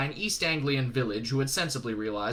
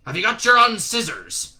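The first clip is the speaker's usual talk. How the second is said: drill sergeant; 8 semitones higher, 10 dB louder than usual. Acted. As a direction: grill him like a drill sergeant. Shadwell interrogates recruit.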